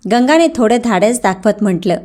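usual speaking voice

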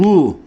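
ru with a thick back U; thick vowel. Shown as a demonstration. Showing learners a thick letter, thick ru. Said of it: "kalın olduğu için".

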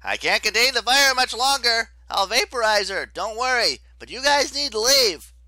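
high, loud, desperate order; urgent shouting. A narrator's reading. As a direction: shout over the flames to send friends away. Leo burning, orders friends away.

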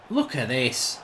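ordinary talk

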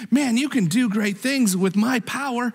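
high-pitched, fervent encouragement; Jesus. Acted. Preacher as Jesus telling a believer of his faith, fervently encouraging. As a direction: cheer them on with rising conviction. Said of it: "that's the voice of Jesus".

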